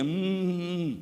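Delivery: lazy closed-mouth hums instead of words; imitating someone answering only with mm; mumbled hums. A preacher imitating an unclear reply, mumbled hums. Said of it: "te dice de que".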